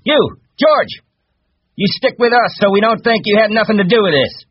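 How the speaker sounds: very high, loud shrieked order; calling out. A narrator's reading. Curly orders George to stick with them, calling out. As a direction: holler after him, accusing. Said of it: "until Curly called".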